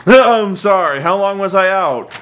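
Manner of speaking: mock startled waking. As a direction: pretend to wake up with a start. Pretends waking from boredom.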